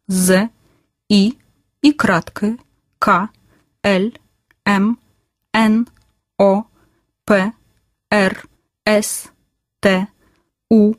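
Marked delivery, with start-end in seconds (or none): none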